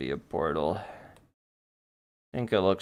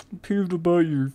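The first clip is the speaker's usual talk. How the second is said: higher-pitched pretend peevishness; mock annoyance; 7 semitones higher, 3 dB louder than usual. Streamer picks a silly fight, mock annoyed. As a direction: play up a silly, petty grievance. Mock quarrel, answering own question.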